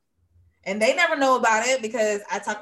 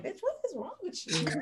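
high, soft, incredulous self-question; mock self-scolding; 10 semitones higher, 6 dB quieter than usual. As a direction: ask it high and under your breath, half laughing. Acted talking to herself.

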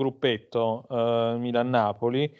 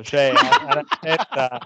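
ordinary talk

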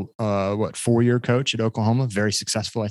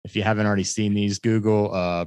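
usual speaking voice

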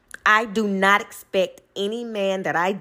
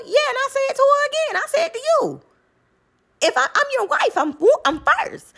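high, fast and insistent; mock heated comeback; defiant retort; faster than usual. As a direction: stand your ground fast. Retold argument with husband.

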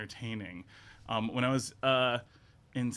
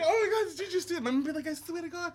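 much higher astonished voices; amazed onlookers. Retold or imitated. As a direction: gasp like shocked passers-by. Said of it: "people would be like".

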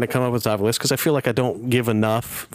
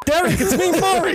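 high silly voice. Acted. Joke voice impression.

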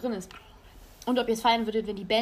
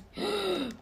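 sharp gasp of surprise; surprised gasp; 8 semitones higher than usual. Real, not acted. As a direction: gasp in amazement.

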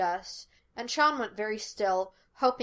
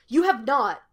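loud, indignant contradiction; quick burst of protest; 3 semitones higher, 7 dB louder than usual. A narrator's reading. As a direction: flatly deny it with a raised voice. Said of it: "Gus protested".

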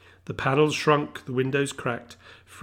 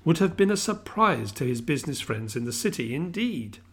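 ordinary delivery

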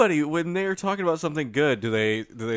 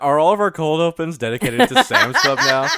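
laughing; 5 semitones higher, 9 dB louder than usual. Real, not acted. Laughing at the bit.

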